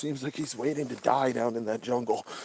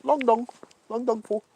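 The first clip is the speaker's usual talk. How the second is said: high-pitched mock Chinese accent; weird Chinese accent; 10 semitones higher than usual. Streamer repeating a chat name, mock Chinese accent. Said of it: "in a weird Chinese accent".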